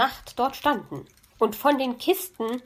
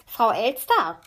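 croaky rasping bird voice; rasping raven; 3 dB louder than usual. A narrator's reading. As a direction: rasp it like a raven. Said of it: "schnarrte es da hinter ihr". (schnarrende Rabenstimme)

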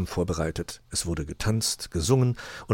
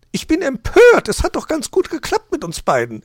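high, loud outraged magpie; worked-up, high-pitched outburst; indignant; 10 dB louder than usual. A narrator's reading. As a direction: protest in a very high voice. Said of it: "ereiferte sie sich".

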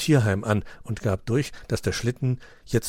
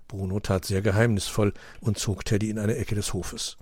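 usual speaking voice

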